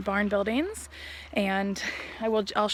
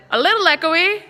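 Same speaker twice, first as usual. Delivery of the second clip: loud, high call to test echo; 7 semitones higher, 11 dB louder than usual. Shown as a demonstration. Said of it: "it's really echoey".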